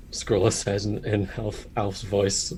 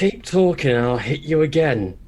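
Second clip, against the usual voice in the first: a touch of Cockney, snapped and a bit higher; slight Cockney snap; 4 semitones higher, 5 dB louder than usual. Acted. Bird warrior snapping at his friends in light Cockney. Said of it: "He snaps up in a slightly Cockney accent".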